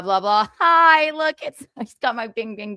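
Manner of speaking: ordinary talk, nothing marked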